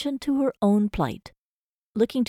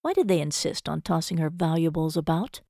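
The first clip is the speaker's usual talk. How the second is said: ordinary delivery, nothing marked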